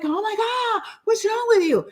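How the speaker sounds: high, exaggerated disbelieving intonation; mock appalled. Mimicking people shocked she is single, mock appalled. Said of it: "they do it with this kind of intonation, like".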